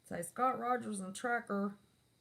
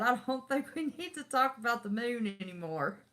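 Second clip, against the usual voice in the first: higher, louder mock huff; retold mad friend; 6 semitones higher, 5 dB louder than usual. Friend shutting down the moon talk, mock mad. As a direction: imitate a miffed friend ending the subject. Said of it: "that made my friend mad".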